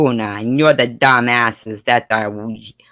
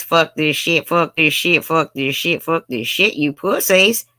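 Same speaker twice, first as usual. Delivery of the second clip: raised, higher-pitched mock tough voice, chanted over and over; hammed-up rough party chant; biggest cigarette banger hall voice; 6 semitones higher than usual. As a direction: chant it like a rowdy barfly. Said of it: "And my biggest cigarette banger hall voice".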